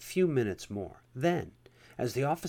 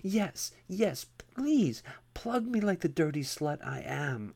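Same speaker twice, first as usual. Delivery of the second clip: high, whiny plea that peaks on please; desperate begging; 7 semitones higher, slower than usual. A narrator's reading. Captive man begging the officer again, high and desperate whine. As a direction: plead eagerly, voice climbing high. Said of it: "I begged".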